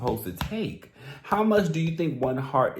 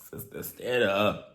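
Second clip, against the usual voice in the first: ordinary talk, nothing marked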